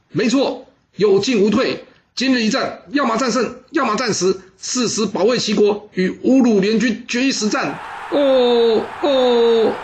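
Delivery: very high, chanted war oath; battle cry. Acted. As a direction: roar it together as an army. Troops shout their battle oath. (齐声怒吼)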